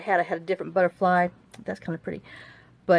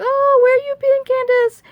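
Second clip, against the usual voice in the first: much higher, louder, put-on Chinese-accented voice; imitating Bunny's Chinese accent; 8 dB louder than usual. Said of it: "she still has her little accent and stuff".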